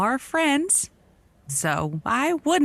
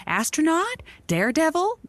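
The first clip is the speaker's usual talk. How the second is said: ordinary talk, nothing marked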